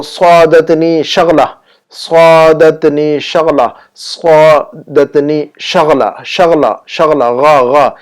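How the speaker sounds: slow سعادتنی شغلہ, isolating the throaty غا; pronunciation drill. Shown as a demonstration. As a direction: repeat شغلہ deliberately, bringing out the ghain. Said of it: "غین کی آواز کو نکالیے".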